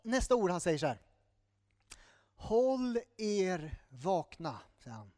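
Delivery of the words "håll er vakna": very slow, weighty call to stay awake; serious exhortation. Acted. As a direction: draw each word out as a grave warning. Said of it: "Det är lite mer allvarligt". (allvarlig, långsam uppmaning)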